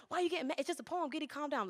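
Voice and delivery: high, hushed acted reproach; self-scolding. Poet retells calming themselves over lost poem, high soft chiding. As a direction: talk yourself down, high and soft. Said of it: "And I was like".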